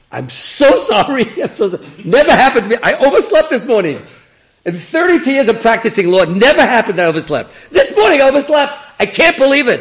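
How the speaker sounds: high, shouted mock apology with laughter creeping in; frantic apology. Lawyer apologising to his client for missing court, hammed-up shouting. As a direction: wail it loudly, hamming up the excuse.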